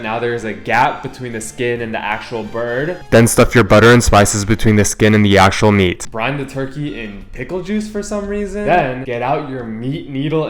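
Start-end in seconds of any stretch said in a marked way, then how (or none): 3.1-6.1 loud. Acted. Loud emphatic cooking instruction.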